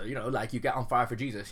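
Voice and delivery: ordinary delivery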